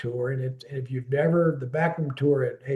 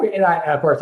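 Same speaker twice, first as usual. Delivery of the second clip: normal talking voice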